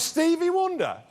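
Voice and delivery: high-pitched mock-outraged echo; incredulous scorn. [name] rejecting the comedian's guess, incredulous.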